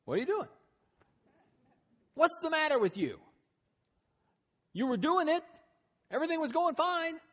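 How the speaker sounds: high, loud exasperated rebuke; hammed-up scolding of a failing friend; mock scolding. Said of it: "Jesus didn't look at him and go".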